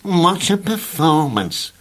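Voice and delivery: slow, unimpressed aside; worm's dry comment; dry remark. Acted. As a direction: drawl it, unimpressed. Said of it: "commented the worm".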